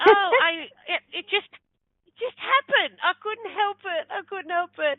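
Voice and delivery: very high, flustered mock protest; pleading excuse. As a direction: plead helplessly as if caught out. Retold excuse to angry husband.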